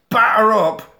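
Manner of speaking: high, loud retold yell; shouted challenge. Andy attacking with a baseball bat, shouting. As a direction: call it out before the swing. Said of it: "And he shouts".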